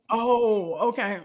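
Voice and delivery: ordinary talk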